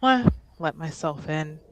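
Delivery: ordinary talk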